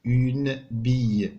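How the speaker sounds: Une bille said slowly and clearly; teacher modelling French; slow model word. Shown as a demonstration. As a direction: sound out Une bille for pupils. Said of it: "شحال فيها مقطع صوتي".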